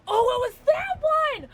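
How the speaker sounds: high, loud realisation; a real gleeful yell, high and loud; excited shout. Real, not acted. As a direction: shout it out in delight. Realising which skin it was.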